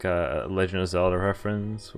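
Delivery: ordinary delivery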